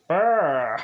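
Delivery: a goofy, loud lip-buzz brr; stoned brr noise. Retold or imitated. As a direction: buzz your lips like you're wasted. Adam very high on cookies.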